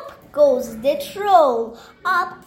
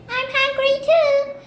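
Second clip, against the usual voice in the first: high goat voice; 11 semitones higher than usual. Acted. Goat voiced high in child's story.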